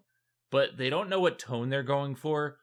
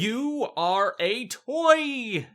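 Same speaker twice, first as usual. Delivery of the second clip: high, slow, shouted toy Santa bark; stretched-out mock yell of a robotic Santa; 10 semitones higher, 4 dB louder, much slower than usual. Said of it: "he's like".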